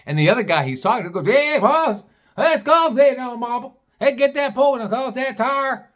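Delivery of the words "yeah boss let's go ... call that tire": much higher, louder, slurred backwoods jabber; garbled hillbilly talk. Retold or imitated. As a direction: rattle it off so only a word gets through. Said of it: "this is how they are talking".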